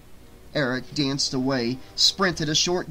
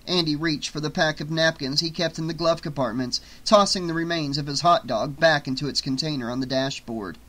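usual speaking voice

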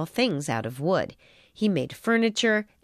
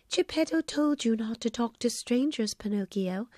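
soft, gentle fairy chiding; a soft, kind reminder, a little quieter; quiet gentle fairy voice, soft scolding; gently; 2 semitones higher, 5 dB quieter than usual. A narrator's reading. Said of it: "the fairy said gently".

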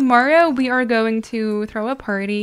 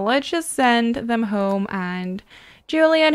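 ordinary talk, nothing marked

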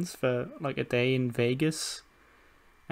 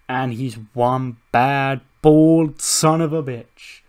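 slow, drawn-out announcer build; ring announcer; 3 dB louder, slower than usual. Acted. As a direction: announce a big reveal, stretching the words and building to a loud finish.

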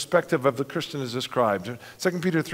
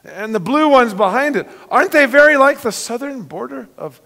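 put-on higher, breathless girl's voice full of wonder; wondering Lucy; 9 semitones higher, 7 dB louder than usual. Lucy gazing at the new hills, high and amazed. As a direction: marvel like a young girl seeing home. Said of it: "Lucy says".

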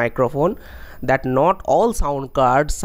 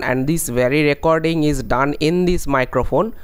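ordinary talk

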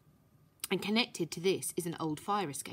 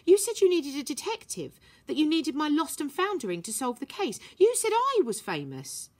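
whiny; 9 semitones higher, 5 dB louder than usual. A narrator's reading. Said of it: "whiny tone".

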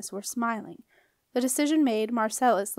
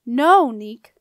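loud, drawn-in alarm; forceful, urgent "no"; shouted protest; 11 dB louder than usual. A narrator's reading. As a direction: shout to make him stop. Septimus again shouts to stop him.

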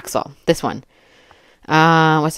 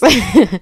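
short burst of laughter; 7 semitones higher, 6 dB louder than usual. Real, not acted. Amused laugh after joke.

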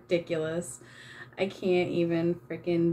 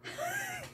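a very high, soft, breathless laugh; squeaky laugh; 13 dB quieter than usual. Real, not acted. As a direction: squeak with disbelief. [name] asks for more face.

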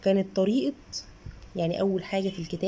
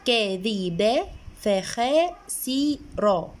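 very slow, each syllable stretched; sounding out vowels; much slower than usual. Shown as a demonstration. Mother reading the book's practice words for a child, very slow. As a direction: drag out every fatha and kasra like a first reader. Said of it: "فبيديني جمل بقى فيها فتحة وكسرة".

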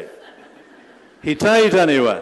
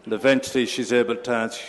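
ordinary talk

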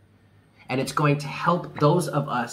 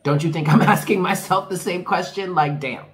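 exasperated retort; 4 semitones higher, 5 dB louder than usual. Real, not acted. Exasperated reply to askers.

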